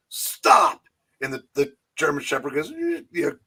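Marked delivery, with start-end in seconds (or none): 0.1-0.8 sharp raised command; commanding stop. Retold or imitated. Henry Winkler stopping the German Shepherd, firm command. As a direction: bark it at the dog. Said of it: "he turns around and goes".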